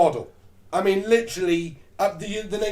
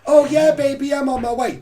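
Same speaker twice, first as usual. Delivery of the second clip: higher, louder flirty gushing; eager flirty reply; 5 semitones higher, 5 dB louder than usual. Retold or imitated. Woman eagerly agreeing to the date, gushing. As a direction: coo it like she can't wait.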